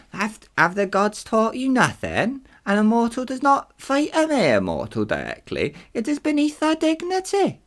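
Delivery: high put-on accented Titan voice; Atlas. Atlas scolding the gods' pupil, high put-on voice. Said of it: "what voice should we do for Atlas".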